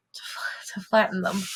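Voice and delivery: breathy whispered aside; whispering. Real, not acted. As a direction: drop to a whisper. Whispered aside about bread.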